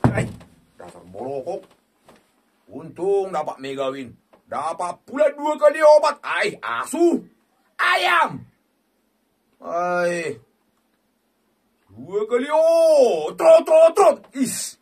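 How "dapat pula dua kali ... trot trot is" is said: very high, loud screaming in joy; ecstatic yelling. Real, not acted. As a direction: scream your delight at the win. Celebrating double win. (teriakan girang)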